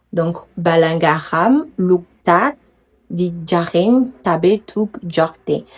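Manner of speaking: dire "balangaram luktak" lentement, syllabe par syllabe; réciter le proverbe wolof en articulant; slow Wolof recitation. Shown as a demonstration. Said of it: "La prononciation wolof, c'est dur". (récitation wolof lente et posée)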